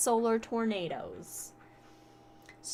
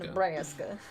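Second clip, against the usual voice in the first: Midwest accent. Shown as a demonstration. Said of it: "It's my Midwest accent".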